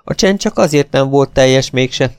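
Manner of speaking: usual speaking voice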